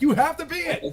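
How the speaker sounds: very high squeaky insistence through a laugh; hammed-up priest cracking up; mock preacher, laughing. Comedian mocking priest's demand, very high and laughing. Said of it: "it's like".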